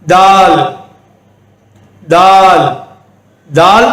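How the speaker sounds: தால் with a firm, hard 'd'; தால் as an emphatic 'daal'; pressed firm d. Shown as a demonstration. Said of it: "அழுத்தமான ஒரு உச்சரிப்பு".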